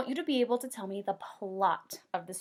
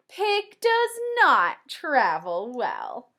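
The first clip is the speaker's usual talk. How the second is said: lively storybook read-aloud, higher and louder; reading to children; 5 semitones higher, 3 dB louder, slower than usual. Acted. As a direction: read the rhyme like a bedtime book for kids.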